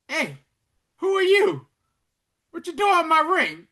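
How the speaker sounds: very high, loud retold demand; startled challenge. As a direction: confront a stranger wearing your ring. Acted challenge by younger Hal.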